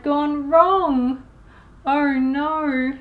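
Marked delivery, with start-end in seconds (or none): none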